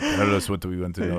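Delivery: put-on generic Eastern European accent; Russian accent. Joking about a stock picture of confession, Russian accent. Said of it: "That was a good Russian accent".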